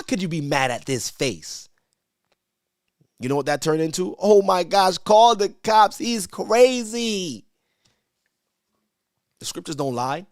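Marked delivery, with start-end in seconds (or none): 4.2-7.5 loud, high mock panic, dragging crazy; panicked parent. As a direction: shriek like a parent who lost control.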